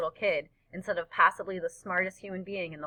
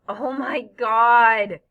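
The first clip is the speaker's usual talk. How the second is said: drawn-out, much louder exclamation; loud whoop; 3 semitones higher, 9 dB louder, much slower than usual. A narrator's reading. Freak whooping it up at his friend's answer, loud drawn-out whoop. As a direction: whoop it up, stretching the last word. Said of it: "he's shrieking and laughing and whooping it up".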